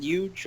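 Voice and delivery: "Huge" said as "yuge", New York style; New York dropped H. Retold or imitated. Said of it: "Is that New York accent way to say it?".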